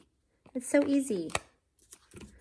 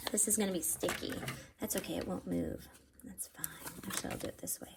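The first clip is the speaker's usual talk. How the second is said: whispering to self; 8 dB quieter, faster than usual. Real, not acted. Muttering to self while working.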